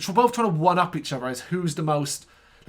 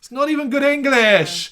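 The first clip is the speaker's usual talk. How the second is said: exasperated outburst; 10 semitones higher, 8 dB louder than usual. Real, not acted. Exasperated outburst at cult name.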